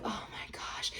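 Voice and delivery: barely audible mock sigh of words; hushed, breath-only imitation; exasperated whisper. Man reacting to her fall.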